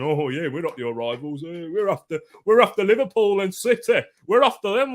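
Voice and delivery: rising, high mock boast of rival fans. Mimics rival fans' bragging.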